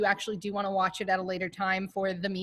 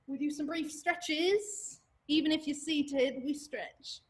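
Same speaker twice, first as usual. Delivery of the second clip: soft, playful put-on British accent; quietly put-on British instructor; 4 semitones higher, 6 dB quieter, slower than usual. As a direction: slip into a mock British accent, higher and lighter. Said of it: "I'll stop talking in a British accent".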